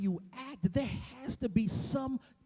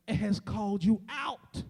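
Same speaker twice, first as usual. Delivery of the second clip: usual speaking voice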